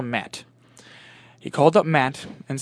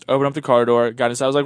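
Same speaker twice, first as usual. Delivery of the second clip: usual speaking voice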